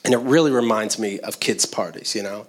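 ordinary talk, nothing marked

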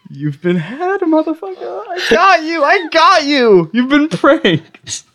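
high, slow gloating prank reveal; prank reveal laughing. Acted.

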